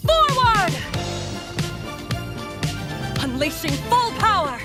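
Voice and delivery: high-pitched combat shout; battle cry. Acted. Centaur vanguard charging at the enemy, high battle cries. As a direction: call it out fiercely as you charge.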